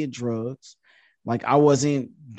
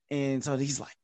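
normal talking voice

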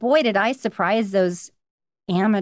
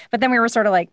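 ordinary talk, nothing marked

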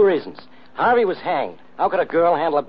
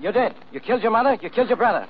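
high, insistent police grilling; pressing hard, voice pitched up; accusing interrogation; 6 semitones higher than usual. Acted. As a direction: hammer the suspect with accusations. Police hammering a suspect.

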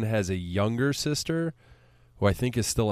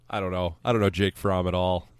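ordinary delivery

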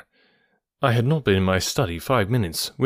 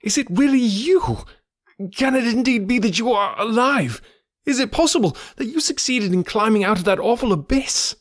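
high, breathless-with-wonder amazement; astonished cry; 12 semitones higher than usual. A narrator's reading. Narrator questions friend believed dead, crying out in amazement. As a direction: pitch it high, scarcely believing it. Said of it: "I cried".

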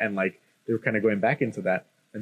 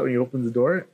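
ordinary talk

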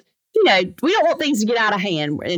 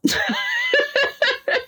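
high burst of laughter. Real, not acted. Laughing at own story.

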